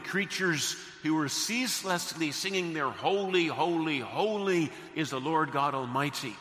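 loud, ringing pulpit delivery; full-force preaching. Real, not acted. Preacher describing God's throne to the congregation, full-force preaching. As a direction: proclaim the vision to the whole congregation.

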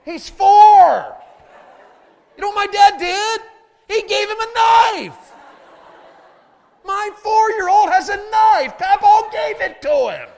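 high, full-force comic yelling; hammed-up, high-pitched bellow of disbelief; outraged shout. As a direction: shout it in incredulous outrage, stretching the key words. Mock outrage at grandpa's knife gift.

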